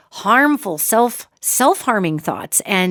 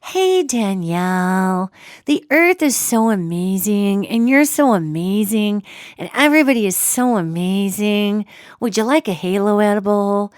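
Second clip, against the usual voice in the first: sugary angel. Pretend. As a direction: play a blissed-out angel offering treats. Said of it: "I'm not getting these".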